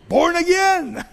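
slow, high, laughing through the words; amused laugh. Acted. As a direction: chuckle it out slowly, amused at the idea. Scoffs at infant baptism party.

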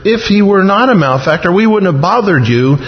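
high, insistent comeback; defensive answer. Acted. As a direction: protest that there was good reason. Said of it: "By the tone of their answer".

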